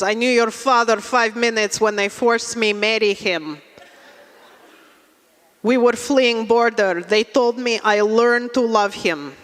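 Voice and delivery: put-on immigrant mom with a Russian accent; Russian mother. Mother tells daughter about her forced marriage, Russian accent. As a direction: recount it plainly in Russian-accented English. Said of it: "She goes".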